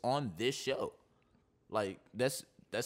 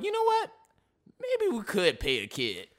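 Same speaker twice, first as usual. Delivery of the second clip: high "you know what", then slow grudging offer; mock reluctant concession; 9 semitones higher, 4 dB louder than usual. Co-host mocking the NCAA's change of heart, mock reluctant. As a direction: lightly act out a grudging change of mind. Mock quote of NCAA finally relenting.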